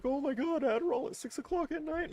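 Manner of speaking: squeaky mocking pearl-clutcher. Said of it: "I know people are gonna be like".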